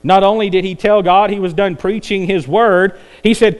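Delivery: ordinary talk, nothing marked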